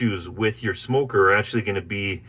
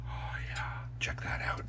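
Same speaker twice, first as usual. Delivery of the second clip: whispered aside; whispering; 14 dB quieter than usual. Real, not acted. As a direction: breathe it out under your breath. Quiet aside while working.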